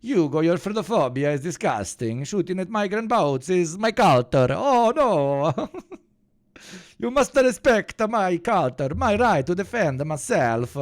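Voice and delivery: put-on over-the-top Italian accent; mock Italian accent. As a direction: play a cartoon Italian with a thick Italian accent. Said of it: "this anti-Italian accent".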